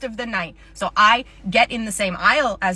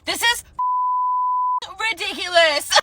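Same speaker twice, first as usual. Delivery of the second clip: high, indignant outburst; hammed-up outrage, broken by a long pause; outraged customer. Customer told of a five-day refund wait, outraged.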